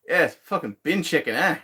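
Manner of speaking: high-pitched, badly faked Australian accent. Streamer naming the bin chicken to viewers, bad Australian accent. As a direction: put on a hammy, unconvincing Australian accent. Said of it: "I can't do an Australian accent worth shit".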